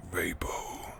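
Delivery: hushed, voiceless whisper; eerie whisper. Acted. Shadowy figure calling from across the road, eerie whisper.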